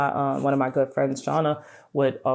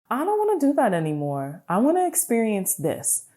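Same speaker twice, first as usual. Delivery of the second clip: eager declaration; 8 semitones higher than usual. Retold or imitated. Acting out wanting change.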